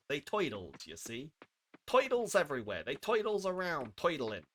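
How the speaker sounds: hammed-up American gangster accent; mock gangster accent. Streamer riffing on toidles everywhere, mock gangster accent. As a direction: put on a cartoon gangster accent. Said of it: "I'm gonna stop doing this voice".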